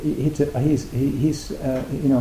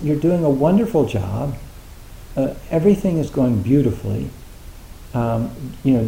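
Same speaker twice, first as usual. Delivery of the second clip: normal talking voice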